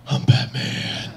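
slow, raspy whispered hero growl; Batman. Acted. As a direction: rasp it out slowly, all breath. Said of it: "I feel like I embody the character".

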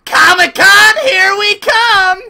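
excited cheer. Real, not acted. Cheering team on.

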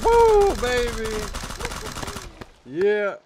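loud high victory whoop; excited whoop. Real, not acted. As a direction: cheer it out big. Squad wins the fight.

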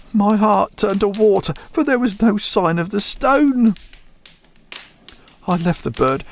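usual speaking voice

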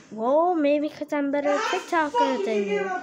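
usual speaking voice

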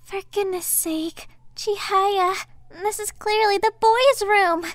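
rising to a loud exasperated scold; embarrassed shout at Chihaya; flustered scolding. Acted.

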